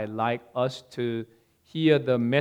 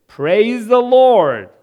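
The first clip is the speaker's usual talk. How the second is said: loud, high, drawn-out cry of praise; joyful praise shout; 8 semitones higher, 8 dB louder, slower than usual. A narrator's reading. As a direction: shout it out slowly to the neighbor.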